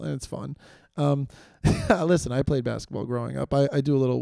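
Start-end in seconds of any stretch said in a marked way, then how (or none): none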